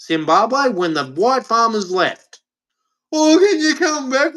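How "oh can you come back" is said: high, hammed-up begging; mock plea. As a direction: mimic a helpless plea, pitched right up. Voices Zimbabwe pleading for farmers' return.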